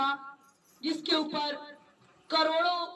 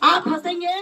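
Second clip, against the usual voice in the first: rousing shout; 2 semitones higher, 9 dB louder than usual. Real, not acted. Preacher rallying his congregation to laugh, rousing shout.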